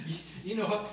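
ordinary delivery

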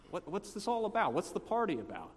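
mildly taken aback; puzzled questioning. Retold or imitated. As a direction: act out a baffled brother asking around. Elder brother questions the guy.

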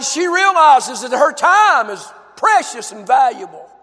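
usual speaking voice

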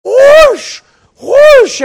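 high, loud whooping whoosh. Retold or imitated. Preacher mimics friend doing screaming laps, loud whooshes. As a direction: imitate someone tearing past, yelling.

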